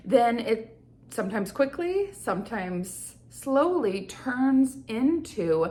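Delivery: ordinary talk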